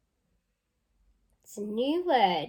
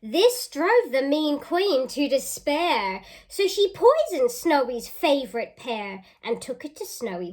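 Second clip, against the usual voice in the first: lively storybook reading; reading to children; 2 semitones higher, 6 dB louder than usual. Acted. Teacher reading a picture book aloud to children. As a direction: read it out with relish for the kids.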